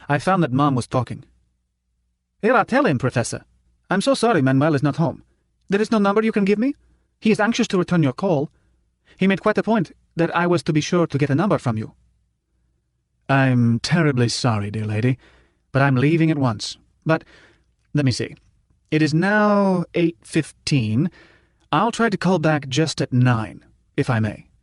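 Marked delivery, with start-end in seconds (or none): 2.4-11.9 higher, faster sweet motherly coo; cooing Mom. Acted. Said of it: "Mom's voice had a coo in it". 13.3-24.5 a hint of a slow, gracious old gentleman; lightly voiced elderly professor, polite and unhurried; gently voiced professor excusing himself; courtly old professor.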